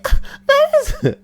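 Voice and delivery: high squeaky mock lady; mock high-pitched lady; prim lady voice. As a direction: start a prissy protest way up high. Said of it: "when she's like".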